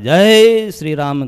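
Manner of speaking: loud, drawn-out war chant; battle cry. Acted. Hanuman leaping off to rescue the monks, drawn-out battle cry. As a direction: stretch it out as a rousing cry.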